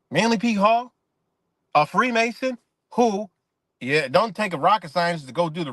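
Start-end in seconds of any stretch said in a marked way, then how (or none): 0.1-0.9 higher, louder, rising echo of the name; incredulous question. Real, not acted. Vlogger questioning the Manly P Hall connection, incredulous. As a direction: throw it back as a disbelieving question.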